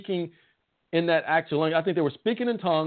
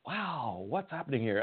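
softer, lower voice of mild wonder; hushed amazement; 4 semitones lower, 7 dB quieter than usual. Acted. Onlookers marveling at the tongues, hushed amazement. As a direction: quietly act out a marveling onlooker. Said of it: "they were all amazed and marveled".

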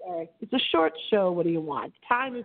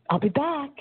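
breathy, higher tagline; 6 semitones higher than usual. Acted. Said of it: "in my breathy voice".

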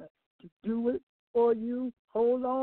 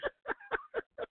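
high squealing laugh; 6 dB quieter than usual. Real, not acted. Laughs at shout track.

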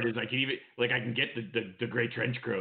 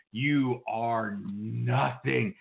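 very slow "You are nothing"; drawn-out sneer; much slower than usual. Acted. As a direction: stretch out every word of "You are nothing". Promo insults.

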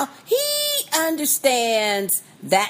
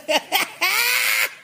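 high delighted laugh; 12 semitones higher than usual. Real, not acted. Delighted at quoted line.

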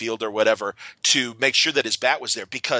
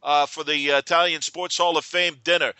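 Yogi Berra voice; 6 semitones higher than usual. Retold or imitated. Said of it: "And he's like".

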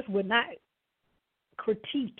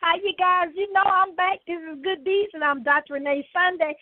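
high, loud and upbeat; excited greeting; 7 semitones higher, 5 dB louder than usual. Real, not acted. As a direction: bounce back on air with energy. Energetic return from ad break.

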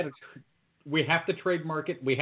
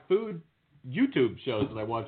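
ordinary delivery

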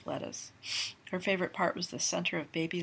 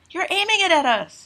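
alarmed shout; 12 semitones higher, 15 dB louder than usual. Acted. Melanie alarmed at cork.

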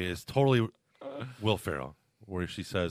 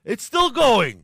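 high, loud, slow emphatic insistence; slow insistent; 9 semitones higher, 6 dB louder, much slower than usual. Real, not acted. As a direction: drag it out, loud and stubborn. Arguing about Friends.

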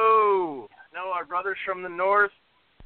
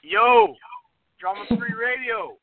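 loud excited greeting; 5 semitones higher, 5 dB louder, slower than usual. Real, not acted. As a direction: shout the yo high and loud, then settle. Caller bursts in on air.

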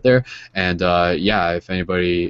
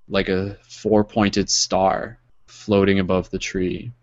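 guided meditation. Acted. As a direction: guide them gently into calm. Leads a closing meditation.

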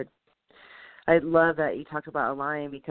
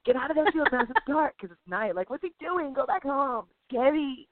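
higher-pitched worried plea, retold; mock alarm; 7 semitones higher than usual. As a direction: plead with the TV in mock worry. Retold pleading with mouse on TV.

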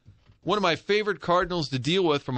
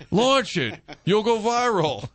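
overcoaching dad; 8 semitones higher, 3 dB louder, slower than usual. Retold or imitated. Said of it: "I'm overcoaching".

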